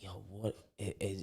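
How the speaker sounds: usual speaking voice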